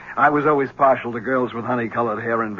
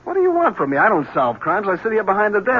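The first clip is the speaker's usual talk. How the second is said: exasperated protest; 7 semitones higher than usual. Acted. Lieutenant brushing off the reporter, exasperated and higher. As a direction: voice jumps higher, fed up.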